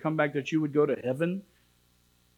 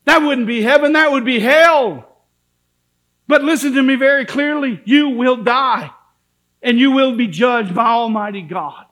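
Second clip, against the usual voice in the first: loud, high, impassioned preaching; fiery preacher at full volume; full-force preaching; 7 semitones higher, 10 dB louder than usual. Acted. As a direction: thunder the warning at the congregation.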